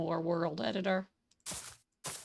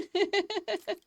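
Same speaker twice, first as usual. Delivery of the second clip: high laugh; 12 semitones higher, 5 dB louder than usual. Real, not acted. After the spider moment.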